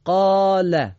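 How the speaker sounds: قال said loud and high, the long vowel held; loud example word. Shown as a demonstration. Teacher calls out قال as a reading model. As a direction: call out قال clearly as a model. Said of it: "یه مثال دیگه".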